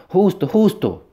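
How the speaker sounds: high, loud desperate call; urgent high-pitched yell; shout. A narrator's reading. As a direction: cry out the name across a distance. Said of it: "torné a gritar".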